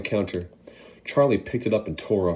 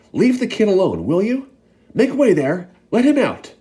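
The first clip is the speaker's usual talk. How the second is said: much higher, louder orders; shouting; 12 semitones higher, 8 dB louder than usual. Acted. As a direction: clear a path. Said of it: "the fat shopkeeper shouted".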